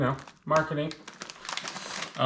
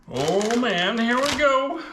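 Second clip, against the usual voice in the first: nervous excitement; 9 semitones higher, 4 dB louder, slower than usual. Real, not acted. Bracing to eat extreme chip.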